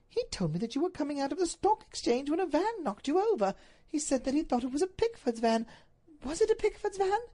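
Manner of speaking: high, earnest lady's voice; high-pitched worried wife; anxious Duchess. Acted. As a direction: recount it, then ask anxiously.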